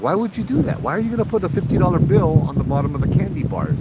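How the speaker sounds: high-pitched retold disbelief; baffled questioning. Asking patient about money under candy bars, baffled questioning.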